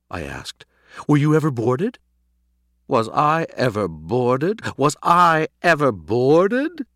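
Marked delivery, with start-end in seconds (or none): 1.1-2.0 higher, louder rising question; curious question. A narrator's reading. Plain question, later echoed indignantly. 2.9-6.9 slow, drawn-out incredulous repeat, building louder; hysterical, high rising indignation; indignant echo. A narrator's reading. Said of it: "His hysteria had subsided".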